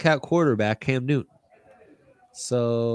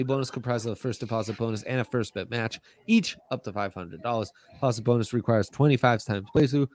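fast legal disclaimer; faster than usual. Acted. Ad read legal terms.

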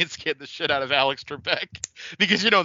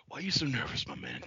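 much softer, husky Jake voice; Jake Roberts impression; 11 dB quieter than usual. Shown as a demonstration. Teaching the co-host the Jake line, softly. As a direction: hush it like Jake the Snake. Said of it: "Like that".

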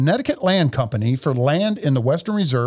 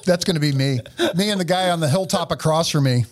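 ordinary delivery

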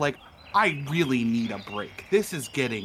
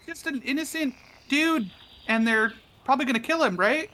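high pleading NPC voice; 12 semitones higher, faster than usual. Acted.

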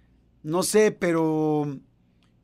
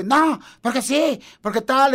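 higher, slow, petulant mock complaint; mock grumpy girlfriend; 6 semitones higher, 4 dB louder than usual. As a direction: whine it like a sulking girlfriend, hammed-up. Husband imitating angry girlfriend.

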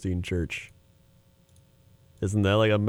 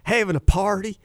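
Joel Osteen impression; 8 semitones higher than usual. Mimicking Joel Osteen to a friend, high upbeat preacher voice. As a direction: sell it like a beaming TV preacher. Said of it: "He's like".

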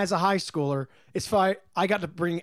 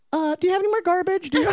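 a high, fast, teasing put-on voice; mock garbage voice; 11 semitones higher, 4 dB louder, faster than usual. Teasing coworker with the garbage joke, high and fast. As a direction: pitch it up and rattle it off like an inside joke. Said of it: "we were always just like".

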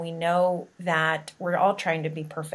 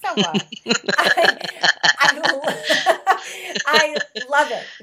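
laughing through the words, higher; laughing delight; 7 semitones higher, 6 dB louder than usual. Real, not acted. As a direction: giggle with real delight. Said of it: "laughing delight at story".

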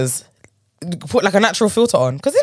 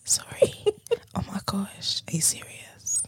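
soft, teasing whisper; 13 dB quieter, slower than usual. Acted. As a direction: whisper it to annoy her friend. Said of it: "I'm not listening".

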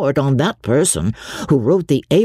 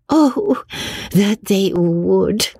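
high, drawn-out longing exclamation; animated cry; 8 semitones higher, much slower than usual. A narrator's reading. Marianne delighted at an imaginary fortune, animated cry. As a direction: cry it out slowly, pitched high. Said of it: "cried Marianne, her eyes sparkling with animation".

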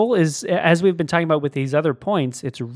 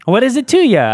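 higher and louder, clipped gangster tone ending on ya; Christopher Walken impression; 8 semitones higher, 7 dB louder than usual. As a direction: land ya like a movie tough guy. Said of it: "That's the Christopher Walken impression".

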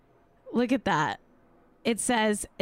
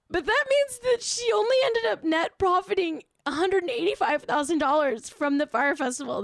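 hammed-up fake crying, pitched way up; mock sob; 9 semitones higher than usual. Mourning a celebrity's fine to viewers, hammed-up fake sob. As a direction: pretend to weep over her lost money.

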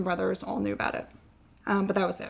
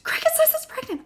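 high whispery mock squeal; freaking out. Wife telling husband of positive test, freaking out. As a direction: re-enact a breathless, high-pitched freak-out. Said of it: "I'm, like, freaking out".

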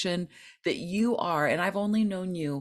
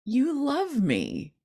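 higher and slow, teasingly sweet; playful reply; 9 semitones higher, slower than usual. Retold or imitated. Answering her negative inner voice, higher and playfully sweet. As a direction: sing it back to the bully voice. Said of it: "saying back to that voice".

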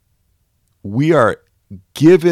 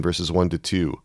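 usual speaking voice

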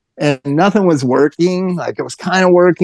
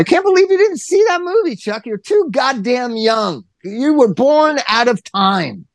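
mock disbelief; 8 semitones higher than usual. Real, not acted. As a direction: rib your friend, slowing right down in exaggerated shock. Teasing friend for not seeing movie.

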